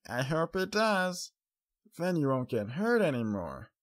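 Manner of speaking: put-on a small child, hopeful; hopeful young filly. A narrator's reading. Child character voice.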